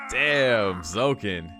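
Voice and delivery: ordinary delivery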